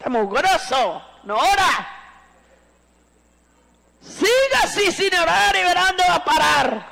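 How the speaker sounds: high-pitched mock nagging at a child; hammed-up high scolding outburst; acted scolding mother. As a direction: imitate a mother fighting with her kids. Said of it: "pelean con los hijos".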